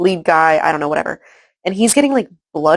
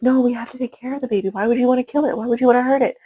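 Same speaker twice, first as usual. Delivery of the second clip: imitating a pleading softie, higher pitched; hurried, high-pitched mock empathetic protest; empathetic plea; 6 semitones higher, faster than usual. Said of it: "he gets all empathetic".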